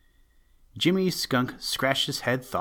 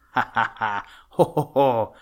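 roaring laughter; 2 semitones higher, 6 dB louder than usual. A narrator's reading. Jimmy laughs at Toad's boast, hammed-up roar. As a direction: roar out each acted ha and ho. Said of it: "roared Jimmy".

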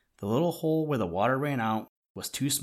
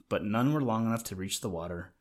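ordinary delivery, nothing marked

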